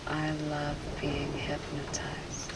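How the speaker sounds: soft, obedient repetition; entranced echo. Real, not acted. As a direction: murmur it back as if in a trance. Subject repeats hypnotist's suggestion.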